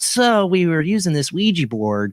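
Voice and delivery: mocking a naive ghost hunter; mocking quote. Mocking people who start with Ouija boards.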